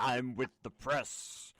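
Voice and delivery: slow, would-be tough reporter; trying to sound tough. Retold or imitated. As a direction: puff up like a teen claiming authority. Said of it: "[name] tries to sound tough".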